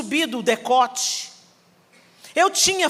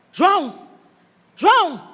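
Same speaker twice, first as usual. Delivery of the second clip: chamando alto; 5 semitones higher, 4 dB louder, slower than usual. Retold or imitated. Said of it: "meu pai chamando o João".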